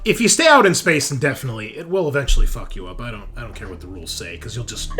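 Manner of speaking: usual speaking voice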